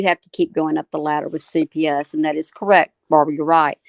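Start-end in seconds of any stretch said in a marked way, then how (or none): none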